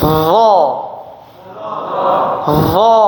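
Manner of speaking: "Dha" said hard with a stiff tongue; tongue tip out, firm "Dha"; heavy dha. Shown as a demonstration. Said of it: "Keras, ya". (ucapkan "Dha" keras, lidah kaku)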